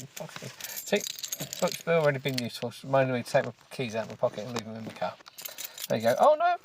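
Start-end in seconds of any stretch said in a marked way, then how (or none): 6.2-6.6 sudden high, loud dismay; alarmed yelp. Real, not acted. Reader flips the page by mistake, alarmed yelp. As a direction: yelp at your own slip.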